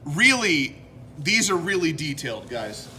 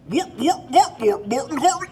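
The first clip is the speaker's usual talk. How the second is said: high bouncy mock gibberish chant; Swedish Chef; 6 semitones higher than usual. Host imitating the Muppet chef for viewers, high mock babble. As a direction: babble it like a cartoon chef in the kitchen. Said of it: "that's a great impre".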